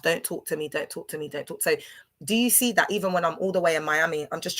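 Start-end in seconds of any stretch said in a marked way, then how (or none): 0.0-1.6 each repeat softer until almost gone; fading self-talk. Retold or imitated. Outside the club telling herself to stop, fading softer. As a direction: murmur it to yourself, fading out. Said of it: "saying to myself".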